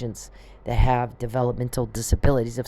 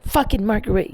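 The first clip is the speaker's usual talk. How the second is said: grumble-yell at an appliance in a raised pitch; mock yell; 9 semitones higher than usual. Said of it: "you're yelling at the microwave".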